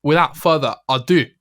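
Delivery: hammy Scottish presenter voice; Scottish accent. Pretend. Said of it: "you did the whole thing".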